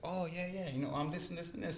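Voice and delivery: put-on eager kid answer. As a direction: imitate a kid proudly rattling off ancestry. Voicing the kids' eager answer.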